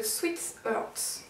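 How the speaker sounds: put-on slow, careful English accent on Sweet Heart; French girl's proud English accent; self-mocking English accent. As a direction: pronounce it the English way, slowly. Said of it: "avec mon super accent English".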